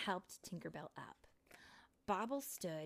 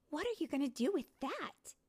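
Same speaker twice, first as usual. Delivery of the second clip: amazed; 10 semitones higher, 6 dB louder than usual. Acted. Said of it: "Tinkerbell asked in amazement".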